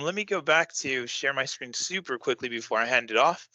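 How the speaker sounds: ordinary talk, nothing marked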